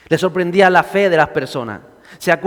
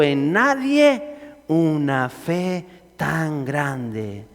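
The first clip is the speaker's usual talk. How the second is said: measured, lowered Bible reading; scripture reading; 3 semitones lower than usual. Acted. Preacher reading Matthew to the church, measured and reverent. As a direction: read the passage with reverence.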